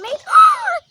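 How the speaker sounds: high sharp gasp of delight; excited gasp. Pretend. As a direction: gasp like you spotted treasure. Spotting a fish.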